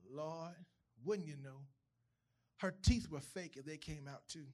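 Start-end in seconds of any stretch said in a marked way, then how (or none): none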